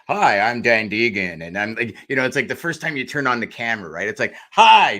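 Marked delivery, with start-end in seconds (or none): 0.1-1.4 loud, drawn-out phone greeting; mock your own over-eager phone introduction; louder, slower salesman hello; put-on phone voice. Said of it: "I would be speaking like this, and I'd get on the phone and be like". 4.5-5.0 HI pitched far too high and very loud; loud, high-pitched test greeting. Shown as a demonstration. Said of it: "No, that's too high".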